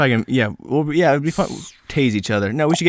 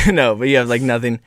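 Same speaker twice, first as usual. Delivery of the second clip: ordinary talk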